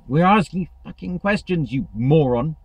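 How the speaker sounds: imitating an angry trainee yelling at the trainer; mock furious retort. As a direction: fire it back at the idiot.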